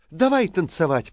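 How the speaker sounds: put-on bright eager girl's invite; eager invitation. A narrator's reading. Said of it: "предложила третья".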